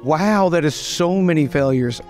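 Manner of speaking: ordinary talk, nothing marked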